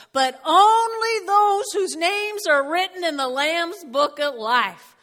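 preaching at full force. Acted. Preaching the key scripture.

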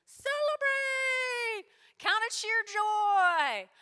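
high, slow, loud celebration; exuberant. Acted. Pastor explaining rejoice, jubilant and drawn out. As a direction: stretch it out with joy.

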